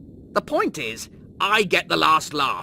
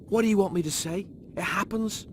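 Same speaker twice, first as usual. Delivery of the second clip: usual speaking voice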